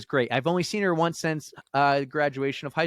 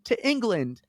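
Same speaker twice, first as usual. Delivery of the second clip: lightly imitated upset passenger, much higher pitch; a little upset; 9 semitones higher than usual. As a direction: retell a mildly distressed plea in a high voice. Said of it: "is getting a little upset about the delay".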